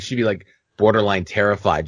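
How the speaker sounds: normal talking voice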